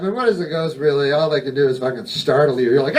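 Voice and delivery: normal talking voice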